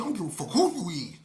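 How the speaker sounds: loud, fast contemptuous threat; menacing snap. Retold or imitated. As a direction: spit it out, he doesn't care. Robber dismisses his name.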